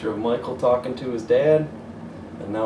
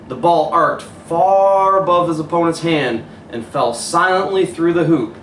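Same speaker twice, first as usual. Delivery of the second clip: higher, lively play-by-play build; excited storytelling; 5 semitones higher, 6 dB louder than usual. A narrator's reading. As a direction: narrate the winning shot with thrill. Excited game narration.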